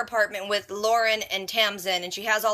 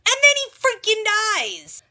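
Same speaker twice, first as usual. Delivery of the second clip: much higher and louder, indignant outburst; outraged exclamation; 11 semitones higher, 5 dB louder than usual. Real, not acted. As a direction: blurt it out in disbelief. Outraged at a character's death.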